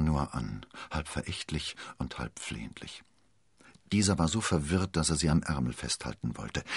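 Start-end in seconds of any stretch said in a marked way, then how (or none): none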